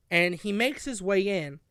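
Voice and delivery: ordinary talk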